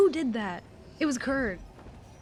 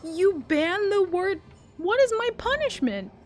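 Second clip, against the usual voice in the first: mock outrage; 10 semitones higher, 4 dB louder than usual. Real, not acted.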